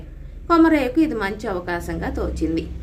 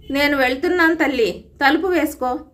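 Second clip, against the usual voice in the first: called out loud and higher from the front room; calling out; 5 semitones higher, 4 dB louder than usual. Acted. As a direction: call out to her through the house. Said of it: "అని ముందు గదిలో నుంచి కేక పెట్టాడు".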